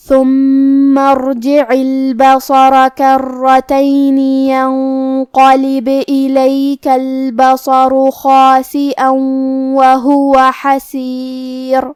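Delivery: slow, melodic tajweed chant; Quranic recitation. Acted. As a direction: recite the verse with measured melody. Recites verses.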